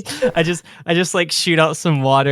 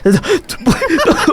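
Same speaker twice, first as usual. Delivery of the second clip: sudden scare shout; 13 semitones higher, 6 dB louder than usual. Acted. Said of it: "I make a fake lunge towards him and I go".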